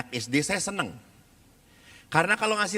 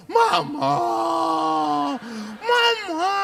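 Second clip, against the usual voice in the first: loud, high wail for mama; hammed-up sobbing child; mock child crying; 12 semitones higher, 4 dB louder, much slower than usual. Priest imitates moved retreat children calling mama, mock wailing. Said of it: "membuat anak-anak terharu".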